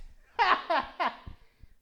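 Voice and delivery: high, loud mocking cackle; loud high-pitched villain laugh; witchy cackle.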